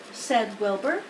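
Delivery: ordinary delivery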